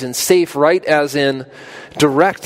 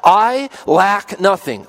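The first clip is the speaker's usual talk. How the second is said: "I lack nothing" drawn out slowly, each word weighted and higher; slow confident stress; 7 semitones higher, 4 dB louder, much slower than usual. Shown as a demonstration. Preacher to his congregation, declaring "I lack nothing" slowly. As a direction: lean slowly on "I lack nothing" with full assurance. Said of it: "and I say that with confidence".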